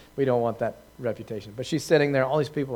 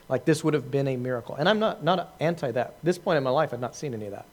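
ordinary talk, nothing marked

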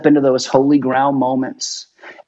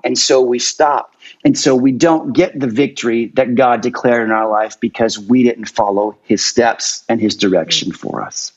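ordinary talk, nothing marked

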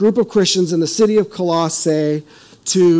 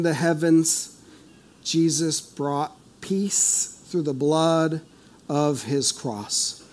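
slow, measured reading of the passage; slow scripture reading; 2 semitones lower, slower than usual. Shown as a demonstration. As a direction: read each phrase slowly and solemnly. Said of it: "I'm gonna read it slow".

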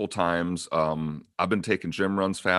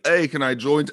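slightly higher, louder eager hail; mock hail to the group; imitating a guildmate; 5 semitones higher, 5 dB louder than usual. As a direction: call it out lightly as the newcomer. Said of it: "he's like".

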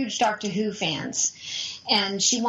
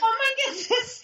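very high, teary, laughing squeal; overwhelmed; 14 semitones higher than usual. Retold or imitated. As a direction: squeal it half crying with joy. Stepmom overwhelmed by famous caller.

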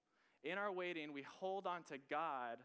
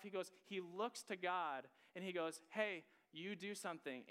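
preaching; 5 semitones higher than usual. Acted. Preaching at full force.

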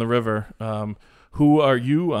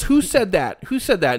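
higher, indignant mock demand; hammed-up offended golfer; whiny little baby; 7 semitones higher than usual. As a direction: play a huffy golfer asking who said it. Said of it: "such a whiny little baby".

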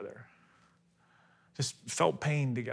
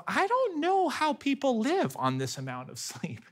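higher, weary exasperated thought; exhausted disbelief; 9 semitones higher than usual. Retold or imitated. A tired dad at night, thinking in exasperation. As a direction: sigh it out like a parent at wits' end. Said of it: "thinking to myself".

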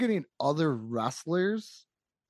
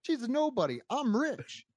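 much higher, sneering Hollywood snob voice through laughter; arrogant Andy Kaufman; 9 semitones higher than usual. Retold or imitated. Podcaster imitating Kaufman's promo to the Memphis crowd, high sneering laugh. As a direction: play the rich jerk mocking the nobody, giggling. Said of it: "He's like".

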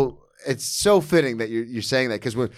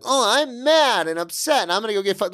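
mocking whine; 9 semitones higher than usual. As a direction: parody the excuse to get high. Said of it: "Like, that's not a thing".

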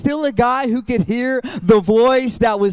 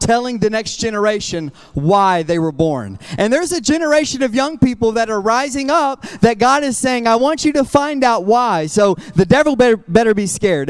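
loud, rousing pulpit delivery; fired-up preacher, driving each phrase home; impassioned preaching; 6 dB louder than usual. Real, not acted. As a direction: preach it hard to the whole church. Preaching at full force.

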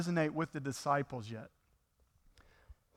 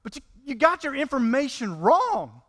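higher, louder pleading as Peter; a disciple urging his master, higher and louder; Peter's desperate plea; 6 semitones higher, 5 dB louder than usual. Retold or imitated. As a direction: beg Jesus to stop, voice pushed up high. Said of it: "here's Peter, maybe his plea".

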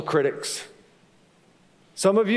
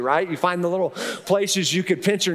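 ordinary talk

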